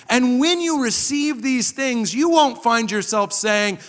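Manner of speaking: high, emphatic sermon delivery; impassioned preaching. Real, not acted. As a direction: raise the pitch and drive the point home. Preaches at full force.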